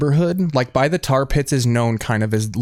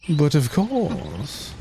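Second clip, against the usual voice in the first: posh butler; 4 semitones higher, 4 dB quieter, much slower than usual. Retold or imitated. Reenacting the Grey Poupon ad.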